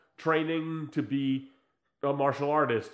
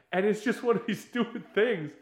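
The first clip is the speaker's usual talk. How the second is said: usual speaking voice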